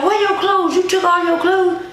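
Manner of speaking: high-pitched, incredulous demands; retold frantic questioning. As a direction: act out an ex in a panic. Ex discovers her clothes are gone.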